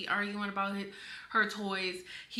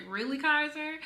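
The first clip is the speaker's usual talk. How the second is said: higher, mock-incredulous question; amused disbelief; 5 semitones higher, 4 dB louder than usual. Retelling own reaction to a five-year-old's drama, amused disbelief in a higher voice. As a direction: quote it pitched up, teasing the child. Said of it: "And I was laughing".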